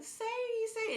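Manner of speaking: very high sing-song pet voice; coaxing cat call. Retold or imitated. Owner calling her cat off the refrigerator, high coaxing.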